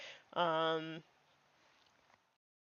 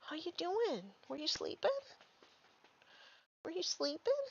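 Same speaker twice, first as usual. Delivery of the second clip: high, soft pet voice; 9 semitones higher, 7 dB quieter than usual. Real, not acted. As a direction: ask the sleepy dog gently, pitched high and soft. Asking the sleepy dog.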